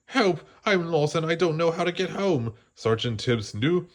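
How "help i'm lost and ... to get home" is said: high, pleading puppy voice; a small lost pup begging for help; puppy pleading to the cat. Acted.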